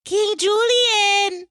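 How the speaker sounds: high, loud, drawn-out squeal; Mort. Retold or imitated. Girl calling the king like Mort, high squeal. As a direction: squeal the name and stretch it. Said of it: "And the girl was like".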